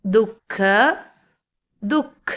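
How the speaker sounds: ദുഃഖ as "duk-kh", no "ha" after du; silent visarga. Shown as a demonstration. Teacher re-reading for learners, ദുഃഖ with the visarga silent. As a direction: say ദുഃഖ in two beats, visarga unsounded. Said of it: "silent ആയതുകൊണ്ട്".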